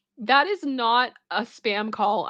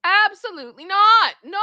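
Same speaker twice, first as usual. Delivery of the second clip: high, loud flat-out no; high indignant no; loud refusal; 10 semitones higher, 5 dB louder than usual. Real, not acted. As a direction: reject it outright, loudly. Outraged reaction to a silly name.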